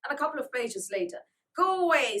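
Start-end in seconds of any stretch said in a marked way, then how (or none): 1.6-2.2 loud, high tantrum scream. A narrator's reading. Joel screaming again during his tantrum. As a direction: scream it while kicking. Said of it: "screamed Joel".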